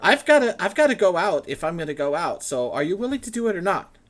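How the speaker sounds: high, loud, flustered scholar voice; excited scholar. Acted. Matrius presses them.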